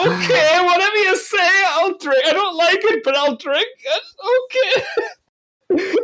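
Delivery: very high, squeaky pretend whimper; mock cowed compliance. Cider drinker giving in to angry Irishman, mock-frightened squeal.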